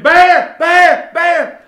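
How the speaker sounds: very loud, high yells of alarm; panicked screaming. Retold or imitated. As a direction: scream a warning across the campground. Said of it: "started screaming".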